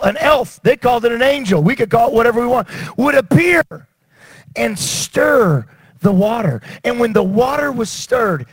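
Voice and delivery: rising fervent sermon cry; full-force preaching. Acted. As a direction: preach it like a revival call to the water.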